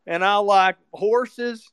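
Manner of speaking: simple Southern man. Retold or imitated. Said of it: "more Southern and more stupid".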